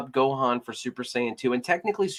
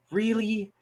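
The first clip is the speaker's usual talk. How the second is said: much higher, exasperated really; exasperated disbelief; 10 semitones higher than usual. Real, not acted. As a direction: push it up in fed-up disbelief. Repeats louder in disbelief.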